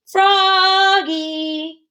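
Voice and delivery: high, loud drawn call. Acted. Teacher calling Froggy in class, loud. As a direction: call him from across the room. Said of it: "called his teacher".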